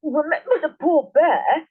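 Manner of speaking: husband calls to wife while chewing; mouth full. A narrator's reading. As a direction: speak through a full mouth. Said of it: "with his mouth so full".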